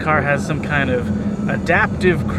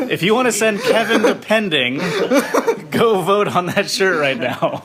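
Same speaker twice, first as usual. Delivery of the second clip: laughing through the words; chuckling while talking; 6 semitones higher than usual. Real, not acted. Joke about vacation to pending.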